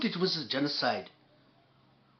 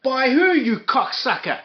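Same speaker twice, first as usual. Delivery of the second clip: furious shout, pitched higher and louder; angry shout; 7 semitones higher, 8 dB louder than usual. Real, not acted. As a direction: bark it back at the screen in rage. Shouting back at the clip.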